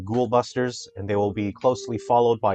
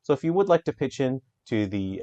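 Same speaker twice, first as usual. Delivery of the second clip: normal talking voice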